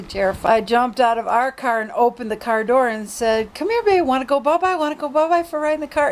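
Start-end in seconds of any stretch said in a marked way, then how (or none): 3.5-6.1 high, sweet coaxing dog talk. Retold or imitated. Woman coaxing a lost dog into her car. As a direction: coax the scared dog over gently. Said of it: "And I just kept saying that".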